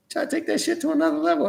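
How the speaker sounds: real laugh breaking through, pitch up; high, fast laughing words. Real, not acted. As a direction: crack up while you say it. Laughing at a stunt.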